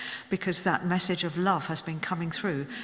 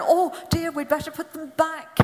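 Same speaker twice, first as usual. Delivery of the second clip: mock childish dismay; 9 semitones higher than usual. Child self voiced in story.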